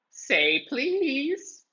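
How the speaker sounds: very high, smug demanding ghost voice; squeaky poltergeist taunt; teasing poltergeist. A narrator's reading. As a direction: make him beg, like a cheeky spirit. Peeves taunting Filch.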